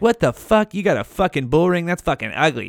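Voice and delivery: usual speaking voice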